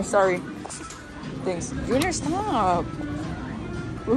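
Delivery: ordinary talk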